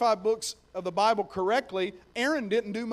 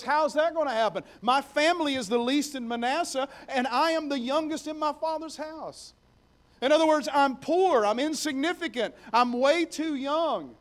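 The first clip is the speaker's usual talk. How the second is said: pleading excuses; 6 semitones higher, 4 dB louder than usual. Acted. Gideon pleads his weakness.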